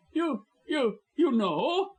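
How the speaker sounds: high, shaken stammer; frightened stammer. A narrator's reading. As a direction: stumble on the words in shock. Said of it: "stammered the scoundrel".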